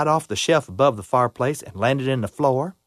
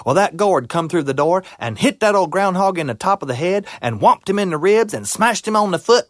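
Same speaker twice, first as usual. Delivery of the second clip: plain reading voice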